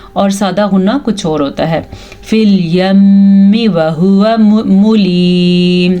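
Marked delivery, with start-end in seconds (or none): 2.2-6.0 slow recitation, held m in "الْيَمِّ", long ī in "مُلِيمٌ"; Quranic recitation with ghunnah. Shown as a demonstration. Teacher reciting the verse for learners, slowly, "الْيَمِّ" hummed. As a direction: draw out "مُلِيمٌ" on a level note. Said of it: "اب میم پہ غنہ ہوگا".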